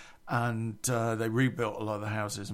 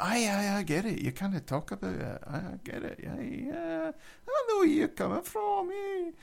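high, hammed-up camp voice; 11 semitones higher than usual. Scottish guy insisting he gets it, in a high camp voice. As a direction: play him breathy and gushing, knowingly camp. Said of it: "I've put on a bit of a camp voice for this guy".

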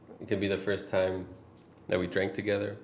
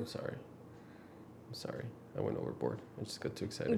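hushed, half-laughing sorry; quiet apology; 10 semitones lower, 15 dB quieter, faster than usual. Real, not acted. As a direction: murmur the apology. Apologizes softly while laughing.

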